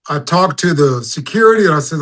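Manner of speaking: ordinary talk, nothing marked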